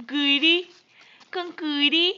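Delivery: loud playful urging; loud coaxing. Real, not acted. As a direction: call out to coax a laugh. Urging baby to laugh.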